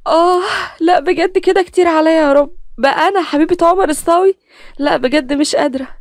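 high, excited and overwhelmed; thrilled, can't believe it; giddy excitement. Acted. Nagham overwhelmed with joy.

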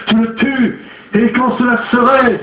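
high, sharp retort; defiant outburst. A narrator's reading. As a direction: fling back the challenge. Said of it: "s'écria Aglier".

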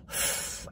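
a long breathy unvoiced sigh like a fed-up dog; imitated dog sigh. Owner imitating her dog's fed-up sigh. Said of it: "and, like, sigh".

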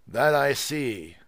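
loud, slow, exaggeratedly patient; elaborate patience. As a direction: drag it out to mock him. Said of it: "with elaborate patience".

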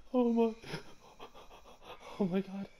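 gasping, high-pitched fright; frightened gasp. Real, not acted. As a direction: cry out in shock. Gasps at monster.

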